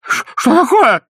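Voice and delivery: high, loud panicked cries; old man shouting when seized; startled shout. A narrator's reading. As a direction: shout in alarm at being grabbed. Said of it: "закричал беспокойный старик".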